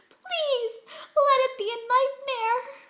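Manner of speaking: high, loud, breaking cry of terror; sobbing plea. Acted. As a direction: beg through sobs, voice cracking high. Pinkie waking in terror.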